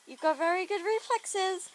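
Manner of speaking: high, lilting sing-song character. Shown as a demonstration. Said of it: "is there a sing-song voice I could do".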